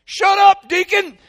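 very high, loud holler; sudden full-volume yell; yelling at dog. Acted. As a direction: bellow at the dog to hush. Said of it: "I'd yelled".

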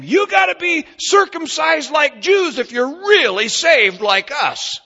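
high, loud, slow insistent shout; imitating the Judaizers. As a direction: hammer the demand at the converts. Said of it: "telling them".

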